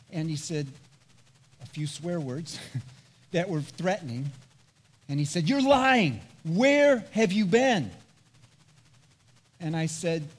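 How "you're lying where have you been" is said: louder, higher, slow accusing bark; officer confronting a lying teen, hammed up; slow stern mock interrogation; angry accusation. Police officer accusing him.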